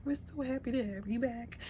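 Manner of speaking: high, light put-on voice; a lightly voiced happy crew greeting; cheery crew voice. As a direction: sing it out like a happy welcome. Voicing the crew's joy.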